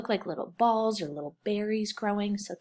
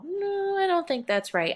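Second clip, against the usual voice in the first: put-on doubting child; 11 semitones higher, 4 dB louder than usual. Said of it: "did you kinda know".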